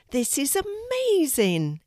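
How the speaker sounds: high, thrilled exclamation; amazed exclamation. A narrator's reading. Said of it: "exclaimed Drew".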